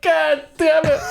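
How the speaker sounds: high, drawn-out curse through laughter; amused, high-pitched retold swearing; laughing mock exasperation. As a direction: imitate exasperation while cracking up. Laughing retelling of caller's exasperation.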